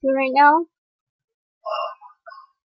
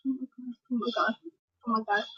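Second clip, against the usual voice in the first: awed hush; 14 dB quieter than usual. Real, not acted. Fan overwhelmed by performance.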